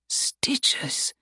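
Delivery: hushed, puzzled whisper; barely voiced inner question; whispered thought. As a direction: whisper it to yourself in disbelief.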